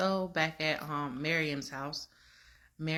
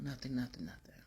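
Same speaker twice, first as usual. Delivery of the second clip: trailing into a whisper; retold denial, dropped to a whisper; 3 semitones lower, 8 dB quieter than usual. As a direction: whisper the nothings away.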